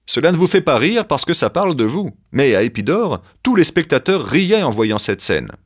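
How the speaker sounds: a hint of an indignant young actor; defensive young actor.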